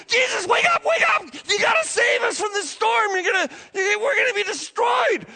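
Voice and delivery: high, loud frantic yelling at sleeping Jesus; terrified disciples screaming over the storm; panicked shout. Retold or imitated. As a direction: scream for him to wake up before the boat sinks.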